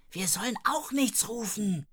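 usual speaking voice

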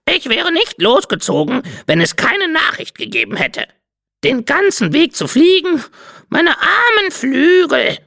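high, squawky parrot complaining; a put-upon parrot bemoaning her poor wings; whiny parrot voice. Acted. Parrot Cinzia whining to the light-bender, high squawky voice.

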